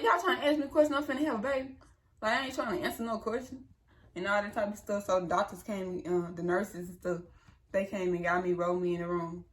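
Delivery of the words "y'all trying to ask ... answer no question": high, fed-up retort; incredulous, higher pitched; exasperated disbelief. Retold or imitated. Exasperated at nurses mid-labor.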